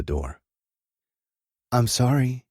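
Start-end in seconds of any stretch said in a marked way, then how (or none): 1.7-2.4 sad. A narrator's reading. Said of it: "came Tina's sad voice".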